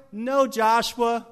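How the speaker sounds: loud, high rebuke, easing at the end; God. Acted. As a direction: thunder back an exasperated correction. God rebukes Joshua's complaint.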